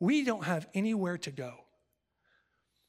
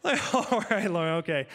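laughing. Real, not acted. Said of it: "I just started laughing".

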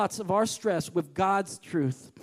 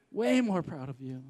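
softer echo; 6 semitones lower, 7 dB quieter than usual. Real, not acted. Softened repeat.

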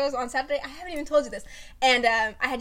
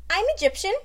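put-on no-accent claim; 8 semitones higher, 6 dB louder, faster than usual. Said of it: "Just speaking like this".

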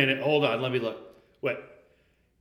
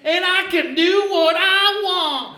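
put-on bratty boy shouting, higher and louder; Samuel Clemens; 9 semitones higher, 7 dB louder, slower than usual. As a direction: yell it like a defiant kid, slow. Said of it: "I'm Samuel Clemens".

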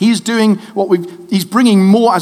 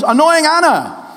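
put-on higher, slow, cheerful little boy voice; cheeky son; 7 semitones higher than usual. Father imitating his son answering cheerfully, a higher, slow child voice. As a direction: play the guileless kid owning it. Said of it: "And he goes".

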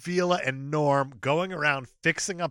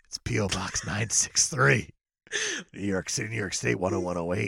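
heavy breathing phone whisper; 10 semitones lower, 4 dB quieter than usual. Acted. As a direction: address said low and creepy. Address repeated in creepy voice.

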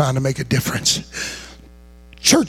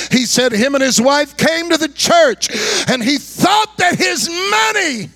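building shout; 12 semitones higher than usual. Retold or imitated. Preacher retelling a pastor's account of a church man, building to a shout. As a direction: climb higher into a full-voiced holler.

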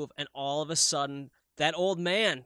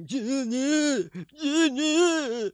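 high strained cries of yeah, muffled; mock whimpering yeah, pitched up; muffled sleep cries; 11 semitones higher, much slower than usual. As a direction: cry out yeah yeah like stuck in a nightmare. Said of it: "I was going like".

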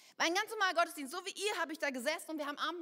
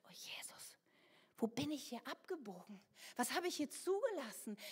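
softer, whispered and dismayed; whispered prayer; 6 dB quieter than usual. Real, not acted. Ashamed believer whispering to Jesus, hushed. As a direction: breathe it out in shame.

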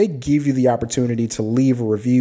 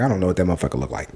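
dismissive retort; 7 semitones lower, much faster than usual. Retold or imitated. Retorting in a retold exchange.

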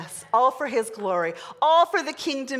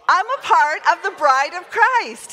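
louder, meaning every word; firm, louder declaration; with conviction; 5 semitones higher, 6 dB louder than usual. Shown as a demonstration. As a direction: declare it like you mean it. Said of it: "Say it like you mean it".

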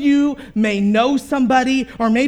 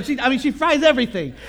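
normal talking voice

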